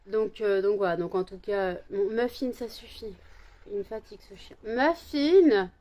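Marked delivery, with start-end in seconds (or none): 2.5-4.6 softer, muttered scolding of the dog; under the breath, tired of the dog; quiet aside. Real, not acted. 4.6-5.7 higher, louder call of her name; calling the dog. Real, not acted.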